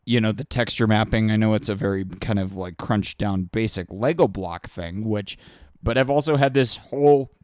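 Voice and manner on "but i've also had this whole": talking through a burp. Real, not acted. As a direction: burp mid-sentence. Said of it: "excuse me, I'm burping now".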